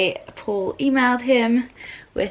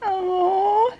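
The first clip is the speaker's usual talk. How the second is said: high, teary "aww"; long whimper of happy tears; real tearful coo; emotional "oh"; 8 semitones higher than usual. Real, not acted. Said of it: "I don't know why I'm getting emotional".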